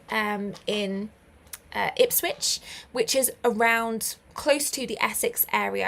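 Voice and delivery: normal talking voice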